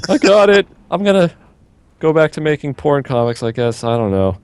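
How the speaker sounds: high squeaky voice fading down; mock intern voice. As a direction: start eager and high, then deflate. Mocking a clueless intern.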